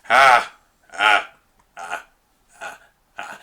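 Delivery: low laugh fading into a whisper; low laugh trailing off in a whisper; whispered villain laugh; Dark Knight Joker laugh. Retold or imitated. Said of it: "Do the Dark Knight Joker".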